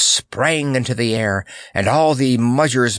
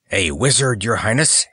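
usual speaking voice

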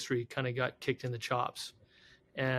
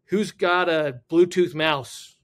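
loud, slow call-out to the room; stern demand; 2 semitones higher, 8 dB louder, slower than usual. Retold or imitated. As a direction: imitate serious guys demanding an answer. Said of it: "three really serious guys walk in".

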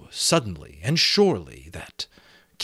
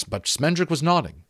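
ordinary delivery, nothing marked

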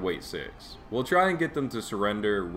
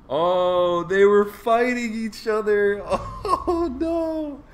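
high, thrilled and giddy; excited squeal; 12 semitones higher, 7 dB louder than usual. Real, not acted. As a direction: squeal with delight. Thrilled at a game event.

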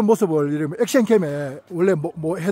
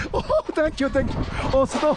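scared yelling; 7 semitones higher than usual. Real, not acted. Yelling while spinning.

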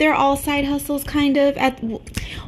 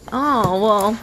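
normal talking voice

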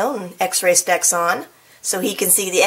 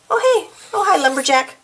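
high, bright hello to the lumberjack; cheery greeting; 8 semitones higher, much faster than usual. Real, not acted. Reader greeting the lumberjack in the picture, cheery high hello.

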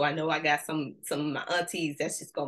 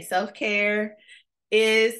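call to repeat; 3 semitones higher, 6 dB louder, much slower than usual. Shown as a demonstration. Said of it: "I need you to repeat after me".

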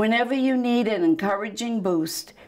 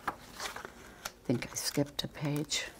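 whisper; 6 semitones lower, 14 dB quieter, faster than usual. Real, not acted. Reader aside mid-book.

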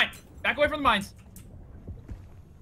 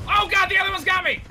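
high panicked yell; panicked shout; 10 semitones higher, 4 dB louder than usual. Real, not acted. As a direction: cry out as you get caught. Caught by enemy in game.

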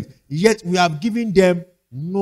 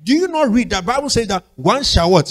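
usual speaking voice

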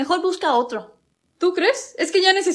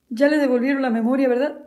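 ordinary talk, nothing marked

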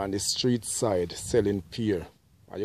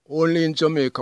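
louder, higher Only in Jamaica; emphatic catchphrase; 6 semitones higher, 7 dB louder, faster than usual. Real, not acted. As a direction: proudly punch the catchphrase.

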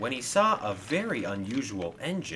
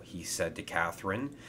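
usual speaking voice

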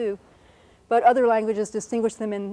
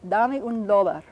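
careful Spanish example phrase; Spanish vowels, evenly demonstrated; Spanish pronunciation; slower than usual. Shown as a demonstration. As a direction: say it in Spanish, as a teacher models it. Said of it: "Give me a dollar in Spanish".

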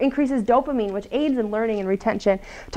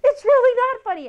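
much higher, pitched-up mock protest; mock angry insistence; 13 semitones higher, 3 dB louder than usual. Angry coworker insisting to the smiling one, high mock protest. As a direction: act out the fuming coworker cracking, hammed up. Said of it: "And they're like".

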